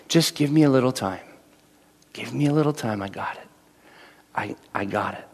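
the Lord gently telling believers to wait; soft reassurance. Retold or imitated. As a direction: promise it gently, almost breathed. The Lord's voice reassuring.

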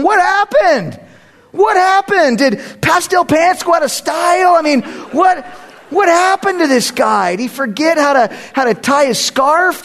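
high-pitched, baffled neighbor outcry; mock neighborhood alarm. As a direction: cry it out like a bewildered onlooker. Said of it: "the whole neighborhood's going".

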